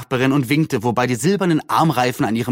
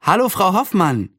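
put-on bright girlish call, higher voice; Anne calling out cheerfully; 7 semitones higher than usual. A narrator's reading. Girl greets her neighbor from the town hall steps, cheerful call. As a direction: call down happily while skipping. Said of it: "rief Anne und hüpfte fröhlich".

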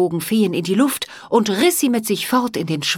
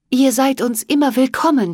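gracious, higher queenly welcome; welcoming queen; 7 semitones higher, 5 dB louder than usual. Acted. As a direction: welcome him kindly like a queen.

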